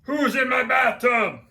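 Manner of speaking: loud, slow, put-on shout from the bathroom; startled shout. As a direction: bellow it out in surprise. Stranger finds seal in bath.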